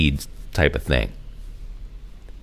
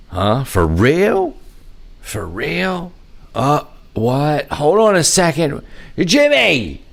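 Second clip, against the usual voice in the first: high-pitched, incredulous questioning; mock confusion; 8 semitones higher, slower than usual. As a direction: act out flustered radio chatter, calling out at the end. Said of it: "You know, there's none of that".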